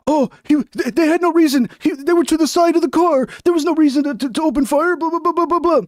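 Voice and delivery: high mocking parody voice; mock the other side's talking points. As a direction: whine it out like you're ridiculing them. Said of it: "And they're like".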